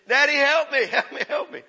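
loud, high cry for help, played for laughs; mock desperate child. Preacher imitating children in trouble calling Daddy, mock panic. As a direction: imitate a kid in trouble begging Dad. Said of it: "when they're in a mess and they're in trouble".

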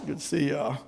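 low, soft, held-in macho greeting; imitated tough guy holding his gut in; gut tucked in. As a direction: greet the guys while sucking in your belly. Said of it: "tuck in your gut".

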